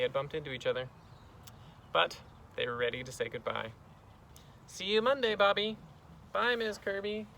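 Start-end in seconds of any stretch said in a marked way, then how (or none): 4.7-5.8 high, singsong goodbye; raised, cheery farewell; friendly teacher. Acted. 6.3-7.3 put-on high little-boy voice; higher-pitched kid's farewell; schoolboy goodbye.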